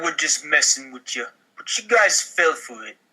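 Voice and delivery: normal talking voice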